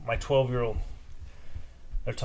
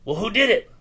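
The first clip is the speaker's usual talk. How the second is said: higher, louder demand; angry yell; 8 semitones higher, 6 dB louder than usual. Retold or imitated. Neighbour yells at the boy to name the culprit, angry. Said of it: "starts yelling and shit".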